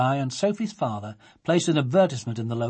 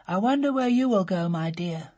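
sad; 5 semitones higher than usual. A narrator's reading. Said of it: "said Sophie sadly".

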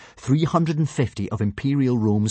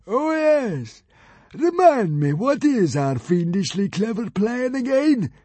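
fat consul voice; 13 semitones higher, 4 dB louder, slower than usual. A narrator's reading.

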